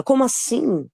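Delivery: high-pitched incredulous challenge; acted taunting disbelief, pitched well up; mock incredulity. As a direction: throw back a disbelieving retort. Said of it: "Na minha fala".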